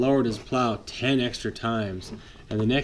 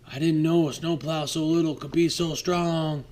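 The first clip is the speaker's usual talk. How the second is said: higher surprised dump truck voice; dump truck humbled; 5 semitones higher than usual. Acted. Dump truck admitting the snowplow is strong, surprised. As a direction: admit it with wonder. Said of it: "he said".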